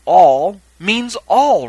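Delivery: "All" loud, slow and high both times; stress on ALL. Real, not acted. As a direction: hammer each "all" to make the point. Insists on literal sense of all.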